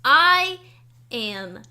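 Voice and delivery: ordinary talk, nothing marked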